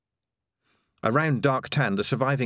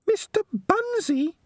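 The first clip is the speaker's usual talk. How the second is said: tiny rat squeak, extremely high; a slow little shriek of a question; Peaches; slower than usual. A narrator's reading. Rat hearing Mister Bunsey dismissed, shocked squeak. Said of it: "squeaked Peaches".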